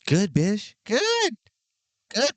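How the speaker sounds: high, squealing praise; cheering himself on, pitch jumping; excited cheer. Real, not acted.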